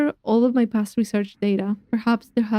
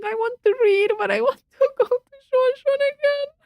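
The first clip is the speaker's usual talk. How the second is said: panicked; 9 semitones higher than usual. Real, not acted. Panicking in a timed game section.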